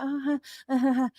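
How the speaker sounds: mocking the idea she's just upset; mock whimpering. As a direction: parody a pathetic little whine. Mocks someone's pitying noises.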